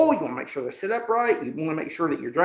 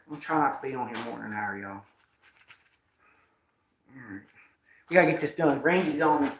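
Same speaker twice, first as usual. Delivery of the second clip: usual speaking voice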